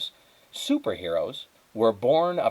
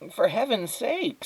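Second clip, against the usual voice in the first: much higher, drawn-out exclamation; exasperated neighbour; 12 semitones higher than usual. Acted. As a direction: neighbour exclaims at the dazed squirrel in a high, drawn-out voice.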